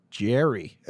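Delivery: JERRY said the American way; mock American "Jerry"; put-on American accent. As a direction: imitate an Americanized Irishman saying JERRY. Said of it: "your half Irish accent".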